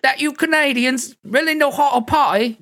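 squeaky fake tourist addressing the Canadians; bad British accent. Fake Brits arriving in Canada to party, high Cockney accent. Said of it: "a terrible British accent".

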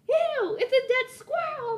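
high, slow disgusted shriek; freaked out. Retold or imitated. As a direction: squeal it like you just saw a dead squirrel. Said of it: "I'm, like, freaking out".

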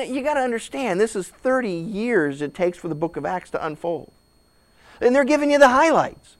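ordinary talk

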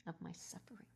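soft, fading words of scripture; hushed, reverent quote. Real, not acted. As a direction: let it sink to almost nothing. Quoting Jesus softly, reverent.

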